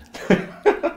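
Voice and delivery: a sudden high burst of laughter; bright real laugh breaking out. Real, not acted. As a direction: laugh out loud at the punchline. Laughing at wife's remark.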